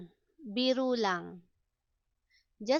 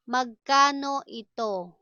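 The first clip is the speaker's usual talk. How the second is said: deliberate, words held apart. Shown as a demonstration. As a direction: stretch the syllables for learners. Slow model, then natural speed.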